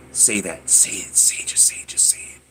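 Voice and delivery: insistent, repeated whisper; urging whisper. Retold or imitated. His brain urging him to mimic, insistent whisper. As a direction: whisper it like a nagging thought. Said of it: "my brain would be like".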